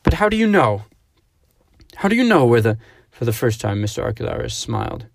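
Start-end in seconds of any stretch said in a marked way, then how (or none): 0.0-2.8 higher, louder desperate asking; frantic question. A narrator's reading. Clarice to Mister Archelaus, frantic and loud. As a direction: press him, rising panic.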